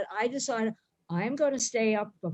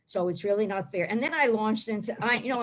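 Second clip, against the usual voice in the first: ordinary delivery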